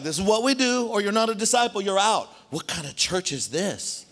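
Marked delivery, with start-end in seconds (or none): 0.0-2.3 high, loud ultimatum; Jesus as a blunt pastor giving an ultimatum; blunt strict pastor. Retold or imitated. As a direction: lay down the law, laughing at it. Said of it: "He'd be like".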